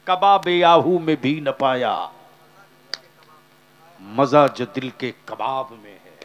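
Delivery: declaimed loudly, verse ringing out; loud fervent recitation; recited couplet. Acted. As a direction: proclaim the poet's lines to the crowd. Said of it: "मेरे रजा ने कहा".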